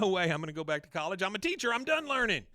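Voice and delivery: high, loud, exaggerated refusal; adamant protest. Peggy refuses to go back to college, loudly adamant. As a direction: climb fast into a comic high-pitched holler.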